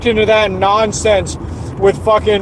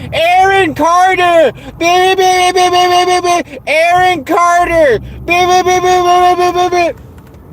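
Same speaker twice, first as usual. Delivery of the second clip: mocking chat crowd; 9 semitones higher than usual. Imitating heathens who sit in voice chat all day, mocking. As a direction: mimic mindless chatter way up high. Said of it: "sit in a voice chat all day going".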